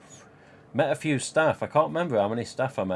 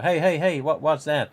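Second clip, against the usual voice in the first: excited curious call; 5 semitones higher, 5 dB louder than usual. Retold or imitated. Curious New Yorkers approaching him.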